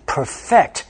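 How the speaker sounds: "perfect" stressed on the second syllable, per-FECT; verb stress. Shown as a demonstration. Teacher models a sentence, "perfect" with second-syllable stress. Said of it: "要读perfect".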